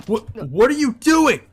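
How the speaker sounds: high, loud scornful demand; scornful Shinji. Acted. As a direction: berate the failing servant. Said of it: "He scorns his servant".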